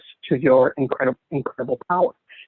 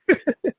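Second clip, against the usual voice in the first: high laugh; 13 semitones higher than usual. Real, not acted. Guest laughing at the host's glitter farts joke, a high burst of laughter.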